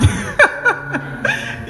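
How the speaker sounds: a quick, very high laugh; high joyful laugh. Real, not acted. Bursting with joy to the congregation, high laugh.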